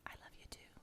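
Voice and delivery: soft loving whisper; tender whisper. Acted. Job answering God's love, tender whisper. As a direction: breathe it back tenderly. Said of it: "Job probably said".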